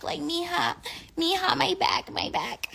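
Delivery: put-on high, whiny old lady groan; whiny grandma. Grandma whining to her granddaughter about her aching back.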